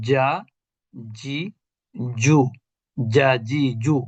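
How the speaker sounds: জা, জি, জু with a firm mid-tongue j; jim pronunciation drill. Shown as a demonstration. Teacher drilling the letter jim for students. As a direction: drill জা, জি, জু syllable by syllable. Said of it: "জিমের উচ্চারণ হবে কেমন?".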